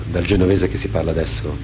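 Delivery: normal talking voice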